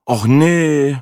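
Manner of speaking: high, loud groan of dismay. A narrator's reading. Narrator dreading the new travel companion, groaning. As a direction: moan it out in protest. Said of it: "stöhnte ich".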